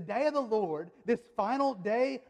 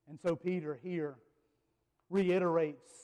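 ordinary talk

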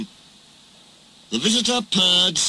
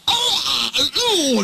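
high shrill-pitched yelps; trucks screaming; 13 semitones higher, 3 dB louder, slower than usual. A narrator's reading. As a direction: cry out like jerked trucks. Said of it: "they screamed".

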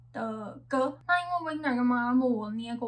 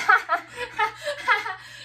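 loud, high laughing fit; high burst of laughter; 11 semitones higher, 7 dB louder than usual. Real, not acted. Laughs at the song result.

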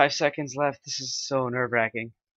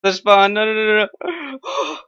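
panicked high cries; 9 semitones higher, 9 dB louder, slower than usual. Real, not acted. Danger near spawn.

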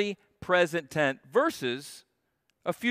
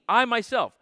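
"I" pitched higher and louder, falling; stress on I myself; 7 semitones higher, 4 dB louder than usual. Shown as a demonstration. Preacher driving home who is speaking, emphatic high stress. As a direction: lift and punch the "I" in "I myself". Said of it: "But the emphasis is".

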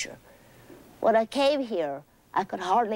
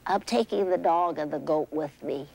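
ordinary delivery

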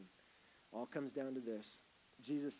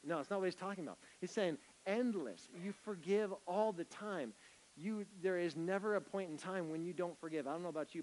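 raised, high preaching voice, stretching key words; full-force preaching; 8 semitones higher, 7 dB louder than usual. Acted. Pastor preaching hard to the church about salvation, ringing and loud. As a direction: preach it out to the congregation with fire.

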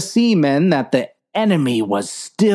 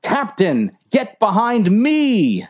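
urgent, higher protective yell; yelled order; 7 semitones higher than usual. Acted. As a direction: shout to shield the captain. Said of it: "yelled Dave".

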